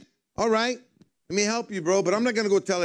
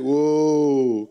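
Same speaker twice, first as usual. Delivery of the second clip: startled whoa; 3 semitones lower, 5 dB louder than usual. Retold or imitated. Nervous fishermen react.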